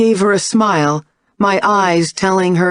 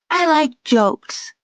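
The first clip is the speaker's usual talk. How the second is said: put-on little girl voice; 11 semitones higher, slower than usual. A narrator's reading. Young daughter voiced by narrator.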